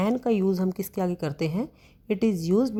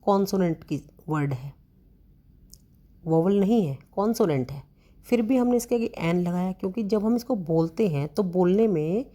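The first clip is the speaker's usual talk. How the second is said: ordinary talk, nothing marked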